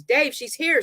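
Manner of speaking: high-pitched cry of alarm; mild scream, pitched well up; panicked scream. A narrator's reading. River warning Dave the girl is here, panicked cry. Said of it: "she screams".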